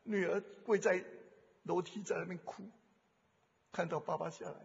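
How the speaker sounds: ordinary delivery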